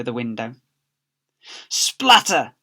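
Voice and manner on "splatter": high, loud splatter; cartoon splatter; comic splatter. A narrator's reading. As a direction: sound effect. Sound effect.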